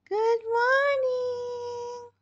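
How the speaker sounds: very high, loud, drawn-out greeting; Chloe. Pretend. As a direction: squeal it slowly as the baby. Baby's greeting to viewers.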